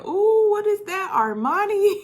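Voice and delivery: high mock impressed dad voice. Dad admiring son's suit, high mock voice. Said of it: "his dad said".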